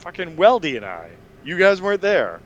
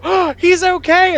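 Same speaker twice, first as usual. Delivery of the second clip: put-on high, slow, louder relieved old neighbors; old neighbors' relief; 10 dB louder, slower than usual. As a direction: coo it out like relieved old ladies. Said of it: "all the old neighbors go".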